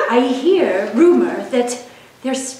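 ordinary delivery

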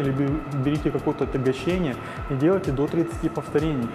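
ordinary talk, nothing marked

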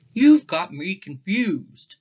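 slowly, gently baffled dad; Papa Bear voice. Acted. Papa questions the cubs' story, a touch puzzled.

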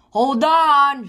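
high, loud shout. A narrator's reading. Gran yells to the children as car crashes, loud and high. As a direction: yell the warning up high. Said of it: "shouted Gran".

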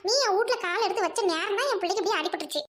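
ordinary delivery

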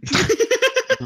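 high, slow words through laughter; laughing. Real, not acted. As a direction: laugh while teasing. Mocking the AI drivers.

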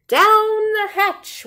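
crew shout. A narrator's reading. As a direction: shout it back all together. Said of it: "we all shouted".

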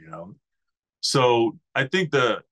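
ordinary delivery

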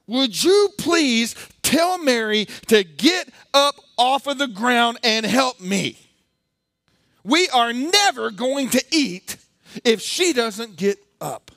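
loud, high, slow angry demand; upset Martha. Retold or imitated. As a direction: hammer each word like a furious hostess. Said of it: "This is an upset woman".

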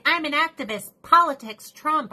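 high, loud mock self-important actor; mock preachy celebrity, pitched high; mocking activist actor. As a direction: parody a preachy celebrity, voice pushed up. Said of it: "they're like".

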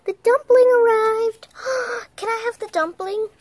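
high, loud announcement, then eager gasp; thrilled pretend arrival, dropping to an eager high question; pretend excitement. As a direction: play up the big news. Pretend delivery, excited child character.

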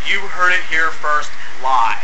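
ordinary talk

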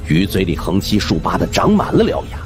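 plain reading voice

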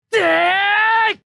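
high, stretched-out battle cry; attack yell. Acted. Pilot striking at top speed, drawn-out yell. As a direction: heave the cry out as you strike.

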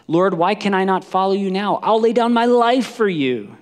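loud, high, impassioned protest; Peter's fervent pledge. Acted. Peter pledging his life to Jesus, loud and fervent. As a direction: vow it with rising fervor. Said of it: "It sounds like Peter".

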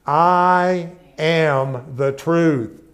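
slow, loud, each word weighted; slow proclamation. Acted. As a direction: lay each word down like a creed. Proclaims the key phrase.

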